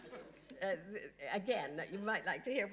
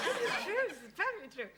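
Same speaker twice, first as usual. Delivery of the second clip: fast, loud, high, laughing insistence; real laughter, words tumbling out; 11 semitones higher, 6 dB louder, faster than usual. Real, not acted. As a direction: laugh while swearing it's true.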